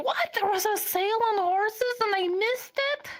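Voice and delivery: high-pitched incredulous outburst; outraged surprise. Retold or imitated. Reacting to news of a missed horse sale, incredulous. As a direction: squeal it in mock-indignant disbelief. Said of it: "And I'm like".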